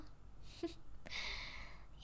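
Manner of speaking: quiet, breath-only laugh; soft chuckle. Acted. Vampire master teasing the human, soft chuckle. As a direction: chuckle under your breath.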